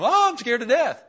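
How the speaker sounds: high, loud cry of mock terror; mock panic. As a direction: blurt it out as panicked people would, hammed up. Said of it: "other people were going".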